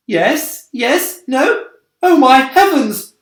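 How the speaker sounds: high, loud, dithering yelps; flustered panic. A narrator's reading. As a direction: babble in a fluster.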